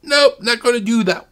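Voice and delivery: high, loud mock refusal; high-pitched, hammed-up "no way"; joking refusal. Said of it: "Like I was kinda joking".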